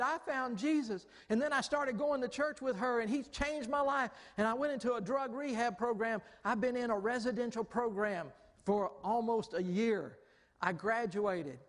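high-pitched, overjoyed retelling; excited testimony. Retold or imitated. Mother telling pastor and wife her changed life, excited. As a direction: gush the good news, imitating her.

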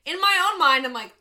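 ordinary talk, nothing marked

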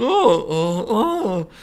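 high mock moans of pain; imitated groans. Hurt patient in the folding bed, imitated groans. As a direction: groan like you're being folded up. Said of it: "you just see Neuberg going".

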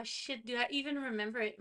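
usual speaking voice

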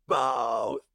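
imitating a distraught kid's low bellow; bawled answer. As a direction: blurt it out as a mock wail. Retold answer of child freaking out.

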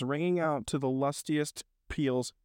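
ordinary delivery, nothing marked

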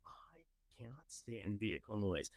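hushed, slow whisper. Real, not acted. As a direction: drop to a breathy secret after the outburst. Whispered aside after loud outburst.

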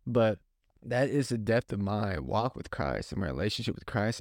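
ordinary talk

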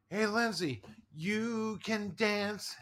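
high, drawn-out mock yell; retold calling out. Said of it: "and I yell over".

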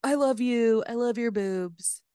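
lightly voiced sappy boyfriend; mocking boyfriend voice. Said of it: "and he's like".

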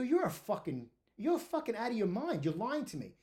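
heated outburst in a retold argument; indignant accusation. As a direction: accuse them, retelling the row heatedly. Retold angry accusation of lying.

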